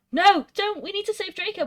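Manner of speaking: high-pitched urgent plea; dramatic storybook begging. A narrator's reading. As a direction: plead loudly, then keep it high. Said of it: "we begged".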